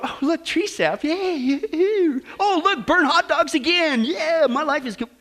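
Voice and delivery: high, drawn-out fake cheer; mock delight. Preacher mimics a camper content with burnt food, mock delight. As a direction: pretend to be thrilled by camping misery.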